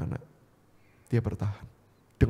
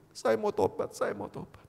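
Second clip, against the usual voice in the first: higher, soft plea dying away; retold tearful plea; 8 semitones higher, 3 dB quieter than usual. Man crying to God in the bathroom, tearful plea. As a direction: act a quiet, tearful repentance. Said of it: "dia nangis dan dia berkata".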